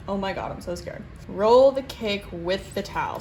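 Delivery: ordinary delivery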